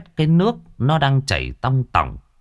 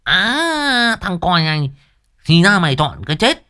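mock threat; 8 semitones higher than usual. A narrator's reading. Boy insulted by his new friend, playful mock menace. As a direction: threaten your mate in play, pitched up.

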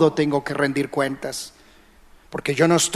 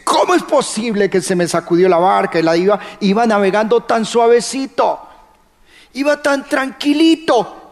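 higher, quickening whine of disbelief; mock disbelief; 5 semitones higher than usual. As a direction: sound baffled the calm sea turned. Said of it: "cuando dice". (incredulidad fingida, subiendo al agudo en tranquilito)

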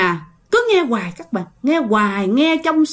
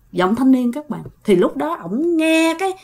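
ordinary delivery

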